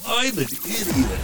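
high, slow, drawn-out catchphrase; exaggerated high self-mocking one-liner; catchphrase drop. Shown as a demonstration. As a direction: stretch it out high for the sound drop. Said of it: "so we can use it as a drop".

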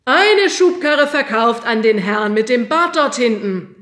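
loud, slow sale announcement; auctioneer call. A narrator's reading. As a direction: declare the lot sold. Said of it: "rief der Auktionator".

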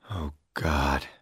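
quiet desperate low sigh. A narrator's reading. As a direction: mutter it into his hands, despairing. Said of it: "he said desperately".

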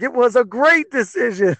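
high-pitched sing-out of reversed opinion; retold mock cheer. Fan reversing his verdict on the fighter's move, retold exclamation. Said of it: "I was like".

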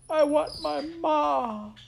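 put-on drawn-out, piercing child's wail; crying out. A narrator's reading. Maid crying into the waiting world, loud slow wail. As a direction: wail it long and high. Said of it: "cried Midge".

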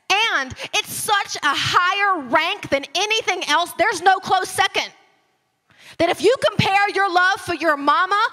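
loud, high, emphatic preaching; preacher at full force; fervent preaching. Acted. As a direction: drive the point home from the pulpit. Forceful sermon climax.

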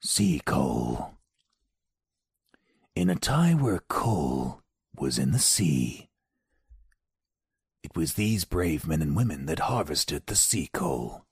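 low, slow, solemn narrator; trailer voice-over. Acted. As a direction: intone it like an epic film trailer. Mock trailer voice-over for docudrama.

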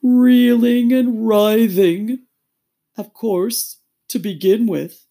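high, loud, drawn-out drawl; slow, woeful turtle lecture; doleful Mock Turtle. A narrator's reading. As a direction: stretch the subjects out. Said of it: "the Mock Turtle replied".

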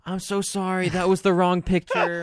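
hammed-up panic over a wrong picture; over-the-top flustered sorry; mock panicked apology. Retelling own frantic apology.

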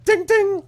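high, loud sung ding ding; mock Law and Order chime; Law and Order sting. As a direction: belt out the TV show sting. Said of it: "like the Law and Order sound".

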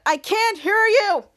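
drawn-out call, unaware of own volume; loud over headphones. Acted. Gamer in headphones answering his friend, loudly.

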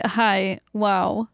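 normal talking voice

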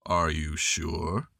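low, slow, mournful donkey greeting; gloomy old donkey voice; gloomy Eeyore. A narrator's reading. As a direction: say it like nothing will ever be good.

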